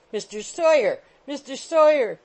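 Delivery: high, loud call from the landing; screamed. A narrator's reading. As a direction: scream the name down the stairs. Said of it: "screamed a voice from the two-pair landing".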